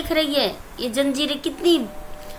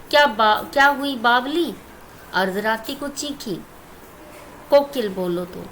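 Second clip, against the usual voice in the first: poem recitation. Shown as a demonstration. Teacher recites poem stanza.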